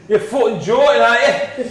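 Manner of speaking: put-on loud laughing Geordie accent. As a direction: shout it like a Newcastle lad. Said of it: "in a Geordie accent".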